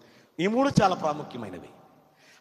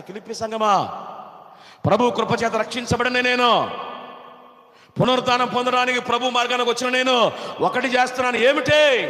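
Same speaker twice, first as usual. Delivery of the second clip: high, loud shouted sermon; fervent preacher's shout, drawn high; full-force preaching; 7 semitones higher, 9 dB louder than usual. Real, not acted. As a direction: shout it out to the whole congregation.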